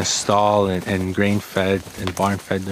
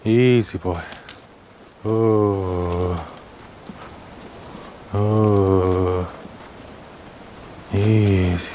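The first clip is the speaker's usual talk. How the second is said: soothing a horse; much slower than usual. Real, not acted. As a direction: gently settle a panicked horse.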